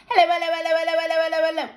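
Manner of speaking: rattled off at top speed, high; a taunting rapid-fire hello; as fast as she could. Acted. Girl taunting the boy with hellos, as fast as possible. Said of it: "as fast as she could".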